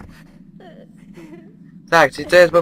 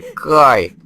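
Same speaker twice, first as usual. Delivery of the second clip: ordinary talk, nothing marked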